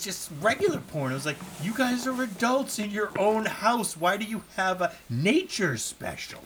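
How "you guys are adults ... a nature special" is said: high-pitched, incredulous telling-off; exasperated scolding. Acted. Podcaster scolding film couple over their disguised tape, exasperated. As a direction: scold them in mock disbelief.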